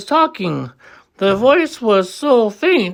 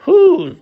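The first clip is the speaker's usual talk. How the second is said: Whos yelled high and loud, falling; scoffing shout; 11 semitones higher, 5 dB louder than usual. A narrator's reading. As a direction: spit out Whos in disbelief. Monkeys scoffing at Horton.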